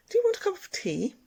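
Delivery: high, quick offer, imitating a nurse; brisk high-pitched mimicked offer; nurse's voice. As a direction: put on a high, solicitous nurse voice. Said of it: "three nurses came and spoke to me".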